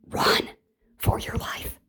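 whispered run for your life; urgent whisper. Real, not acted. Warning viewers to escape the narc in a whisper. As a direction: breathe the warning. Urging to flee.

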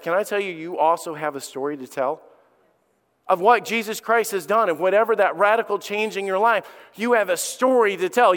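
usual speaking voice

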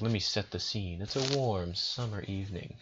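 soft, mock-literary storyteller voice; author-like narration. Storyteller playfully opens the tale, mock author-like narration. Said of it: "author-like".

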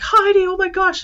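high, loud fangirl squeal; starstruck gushing. Retold or imitated. Attendees gushing at Heidi like a star, high and loud. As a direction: gush at her like a celebrity fan.

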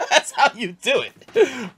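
slow laughing delight. Real, not acted. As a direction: laugh it out slowly. Repeats line, laughing.

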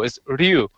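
"Ryu" as one single syllable; correct Ryu. Shown as a demonstration. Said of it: "it's one single syllable".

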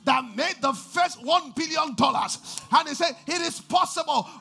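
loud, fervent preacher's cry; shouting the sermon's climax; full-force preaching. Acted.

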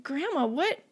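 put-on curious child. Small child asking grandma in the grocery line, high and curious. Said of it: "the child's just like".

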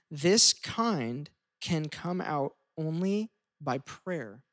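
slow, weighty scripture reading; slow solemn reading. Acted. Pastor reading Jesus' words, slow and weighty. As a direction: let each word land slowly.

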